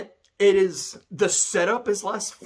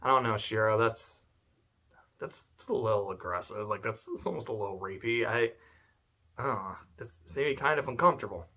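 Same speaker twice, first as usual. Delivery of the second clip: much softer and lower, halting and awkward; hesitant, uneasy; 8 semitones lower, 9 dB quieter, faster than usual. Retold or imitated. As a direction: back away from it uncomfortably. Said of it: "I'm like".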